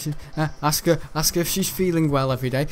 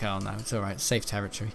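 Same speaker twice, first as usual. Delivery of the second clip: low, much softer mock relief, almost to himself; relieved inner thought; 7 semitones lower, 9 dB quieter than usual. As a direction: mutter it like a private sigh of relief. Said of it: "so I was like".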